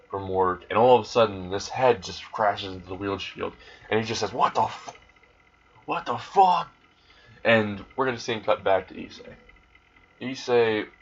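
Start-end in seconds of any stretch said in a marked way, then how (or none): 4.3-6.7 jolted driver yelling in shock; shocked outburst. Acted. Head crashes into driver's windshield.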